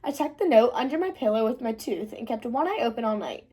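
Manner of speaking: plain reading voice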